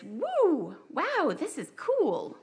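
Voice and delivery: high, slow and wondering; excited inner voice. Retold or imitated. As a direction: gasp it out in awe. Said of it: "my brain says to me".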